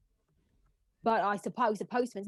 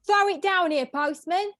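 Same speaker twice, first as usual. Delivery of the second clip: calling up; 7 semitones higher, 5 dB louder than usual. Acted. Mistress calling up to the postman, loud and high.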